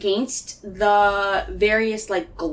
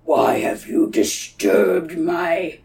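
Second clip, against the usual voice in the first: slow, deep-throated monster boom; 3 semitones lower, 3 dB louder, much slower than usual. Acted. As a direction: loom over them, drawn out and menacing. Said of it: "And he's just like".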